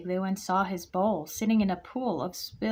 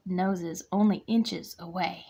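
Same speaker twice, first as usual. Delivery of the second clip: usual speaking voice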